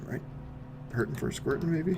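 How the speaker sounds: ordinary delivery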